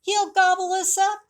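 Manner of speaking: high, loud squeaky panic; bunny crying out in fright; frightened cry. A narrator's reading. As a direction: squeal it in terror. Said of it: "cried a bunny".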